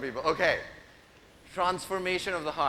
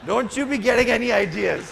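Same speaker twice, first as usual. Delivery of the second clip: mock warning; 6 semitones higher, 9 dB louder than usual. Real, not acted. Joking warning to the boys.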